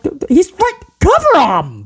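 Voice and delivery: high-pitched exasperated mock yell; retold yelling. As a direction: yell at the screen in disbelief, without full volume. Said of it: "B C is yelling at this point".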